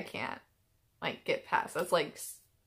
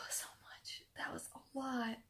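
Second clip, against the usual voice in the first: very soft, tearful near-whisper; sigh, then barely voice it through real tears; sniffly and crying; 4 semitones higher, 14 dB quieter than usual. Real, not acted. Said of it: "Sniffly and crying".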